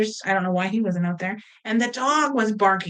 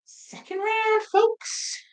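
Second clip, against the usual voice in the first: announcing; 12 semitones higher, much slower than usual. Real, not acted. Announces treats to viewers.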